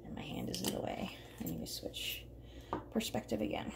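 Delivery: muttered whisper to herself. Real, not acted. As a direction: whisper it as a side note. Aside about camera angle.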